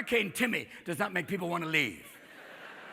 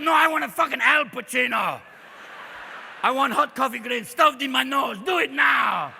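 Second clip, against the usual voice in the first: loud, higher, jittery demand for caffeine; wired coffee rant; 5 semitones higher, 5 dB louder than usual. Acted. To the barista offering a cappuccino, shouting wired and angry. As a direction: bark it like a frantic addict.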